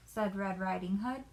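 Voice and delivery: plain reading voice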